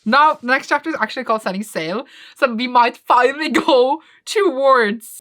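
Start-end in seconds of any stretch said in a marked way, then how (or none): none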